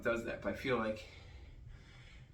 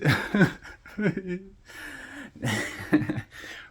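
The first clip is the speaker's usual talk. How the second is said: laugh; 8 semitones higher, 4 dB louder than usual. Real, not acted. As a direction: laugh with a falling pitch. Laughing at own pleading.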